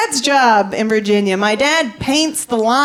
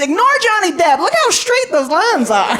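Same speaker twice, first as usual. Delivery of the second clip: excited dad; 8 semitones higher than usual. Retold or imitated. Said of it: "He kept sending me pictures".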